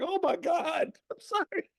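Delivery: tiny, very high apologetic squeal; mock fearful. Lady insulted by the aunt, mock fearful apology. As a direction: imitate a terrified stranger apologising. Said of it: "she was in fear of her life".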